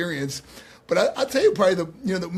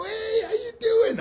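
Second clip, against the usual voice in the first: cheery greeting; 3 dB louder, slower than usual. Retold or imitated. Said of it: "I go".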